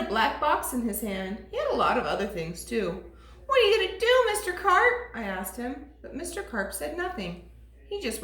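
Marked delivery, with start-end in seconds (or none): 3.4-4.9 put-on anxious boy.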